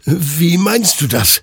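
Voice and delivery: high, slow, bewildered question; confused. A narrator's reading. Soldier confused by the souls' answer, high bewildered question. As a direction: ask it in baffled alarm, pitched high. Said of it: "stammelte Hector verwirrt".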